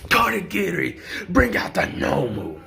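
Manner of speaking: high, strained villain outburst; frantic villain. Retold or imitated. Storyteller as villain scratching himself, high frantic mock cry. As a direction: call for backup in a frantic villain voice.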